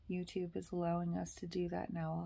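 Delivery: ordinary talk, nothing marked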